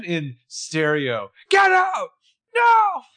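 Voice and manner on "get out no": high, loud, hammed-up shout; mock outcry. Imagined twin shouting in stereo, mock high outcry.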